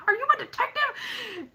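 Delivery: high, slow mock-incredulous question; teasing amazement. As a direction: tease her as some super sleuth. Retells teasing friend as a detective.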